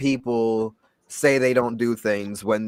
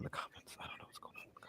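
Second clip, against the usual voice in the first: whisper; 27 dB quieter than usual. Real, not acted. Aside while checking the comments.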